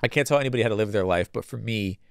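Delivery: normal talking voice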